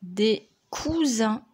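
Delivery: dictée lente, mot bien détaché. Shown as a demonstration. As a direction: articule lentement le mot à écrire. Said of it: "Dernier mot". (dictée lente et posée)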